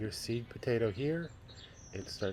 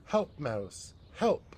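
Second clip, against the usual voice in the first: rising to a much higher, louder help; calling for help; 5 semitones higher, 3 dB louder, much slower than usual. Acted. Cat calling the mouse for help, rising cry.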